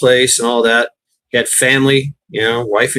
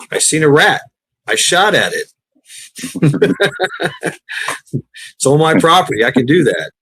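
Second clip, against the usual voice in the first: ordinary talk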